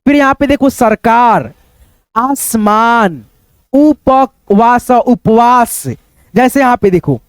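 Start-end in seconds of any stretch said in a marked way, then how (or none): 2.2-3.2 आसमान very slow, as आस-मान; teacher's drawn-out reading of आसमान; split into parts. Shown as a demonstration. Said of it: "दो भागों में divide करके". 3.7-4.9 उपवास very slow, as उ-प-वास; drawn-out, separated उ, प, वास in उपवास; split into parts. Shown as a demonstration. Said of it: "दो भागों में डिवाइड करके".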